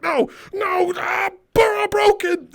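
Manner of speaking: high, hammed-up wailing cry; mock wail over phone. Big customer wailing over crushed phone, high hammed-up cry. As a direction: wail it like a comic tragedy.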